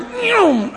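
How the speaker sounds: high mock car whoosh, with a laugh. Bishop imitating a second passing car whoosh. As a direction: mimic the car speeding by. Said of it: "another car would".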